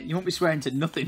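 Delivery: usual speaking voice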